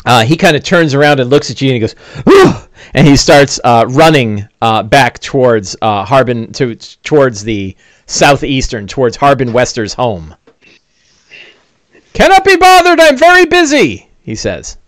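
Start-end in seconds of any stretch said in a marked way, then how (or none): 2.3-2.5 short high-pitched "whew"; startled whew. Acted. Man turns from the notice board to run, startled whew. 12.1-14.1 very high, squeaky fussy little man; harried busybody. Acted. Thin man running from the heroes, harried high voice.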